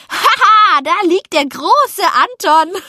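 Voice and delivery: high, loud gloating jeer; slow, jeering laugh-and-shout; mocking call. A narrator's reading. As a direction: crow over the fallen braggart. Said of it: "und ich rief".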